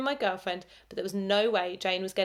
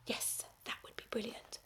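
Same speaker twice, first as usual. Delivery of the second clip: hushed dreamy whisper; whispered daydream; 2 semitones higher, 13 dB quieter than usual. A narrator's reading. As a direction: breathe it like a secret wish. Dreamy private thought.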